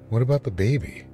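usual speaking voice

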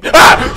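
loud, high shriek of fright; startled scream. Real, not acted. Player ambushed while leaving, startled scream. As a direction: scream as if suddenly grabbed. Jump scare while escaping.

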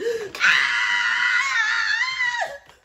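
huge high shrieking gasp; loud gasp. Real, not acted. Reliving her first romance, loud shrieking gasp. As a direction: gasp as if the memory hits you. Overwhelmed by diary memory.

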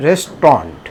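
রেস্টুরেন্ট as two syllables, "res-tront"; no middle vowel in রেস্টুরেন্ট, ending "-tront"; correct pronunciation. Shown as a demonstration. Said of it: "সঠিক উচ্চারণ কী হবে".

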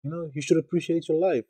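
high, loud, startled plea; urgent, pleading pushback at a friend; shocked disbelief. Retold or imitated. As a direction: react like you can't believe what she just said. Shocked reply to suicidal classmate.

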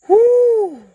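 high loud whoop from the heat; burning mouth. Real, not acted. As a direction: gasp it out as the spice hits. Reacting to hot pepper bean.

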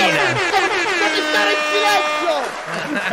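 high, slow triumphant yell; shouting. Pretend. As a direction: scream it over the crowd. Mock celebration after goal.

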